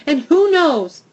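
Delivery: loud, high rush of power; surge of power. Acted. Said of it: "feeling a surge of power".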